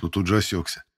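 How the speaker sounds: usual speaking voice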